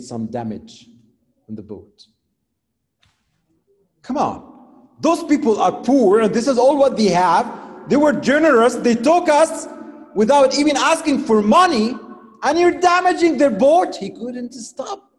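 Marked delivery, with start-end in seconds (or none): 4.0-6.2 higher and louder, pleading in outrage; indignant protest. Acted. Prophet protesting the damaged boat. 6.2-7.9 all punched, higher and louder in outrage; indignant protest. Acted. Prophet protesting the damaged boat. 7.9-14.0 higher and louder, pleading in outrage; indignant protest. Acted. Prophet protesting the damaged boat.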